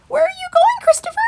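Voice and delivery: very high, loud rabbit voices; young rabbits. A narrator's reading. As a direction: squeak it after him. Rabbits call after him.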